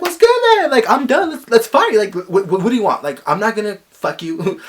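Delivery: high, loud taunting challenge; mock fight challenge. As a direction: dare him to swing.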